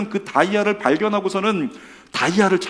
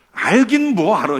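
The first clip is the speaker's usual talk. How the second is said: dismissive retort; 5 semitones higher, 6 dB louder, slower than usual. Retold or imitated. Children's dismissive reply imitated.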